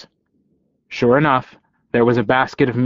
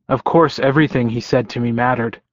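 ordinary delivery

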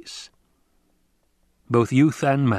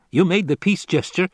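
high, lilting tenor henchman; pitched-up courtier's reminder; light tenor answering the master; Piter; 8 semitones higher, 4 dB louder than usual. Acted. Henchman answers back to his master.

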